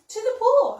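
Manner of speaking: high, loud yell. Acted. Boy echoing the firemen, loud high yell. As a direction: shout the answer back. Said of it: "I yelled".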